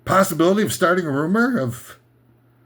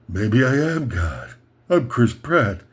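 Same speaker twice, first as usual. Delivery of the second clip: low, slow smug self-talk; a lower, slower pompous musing; mock Chris Pratt voice; 6 semitones lower, slower than usual. Lower, slower mock Chris Pratt thinking he is God.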